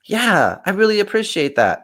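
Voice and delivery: stunned, then touched; a stranger taken aback by a compliment; surprised thanks. Retold or imitated. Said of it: "They're like".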